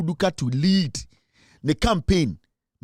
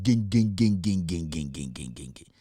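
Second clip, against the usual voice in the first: imitated machine noise winding down lower and softer; machine shutting down; 9 semitones lower, 5 dB quieter than usual. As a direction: let the ging sounds die away like an engine stopping.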